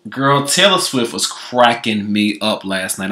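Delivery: ordinary talk, nothing marked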